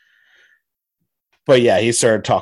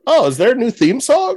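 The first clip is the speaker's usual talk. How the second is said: high, excited mock surprise; 12 semitones higher, 3 dB louder than usual. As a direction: pretend to gasp at a brand new theme song. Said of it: "And I'm like".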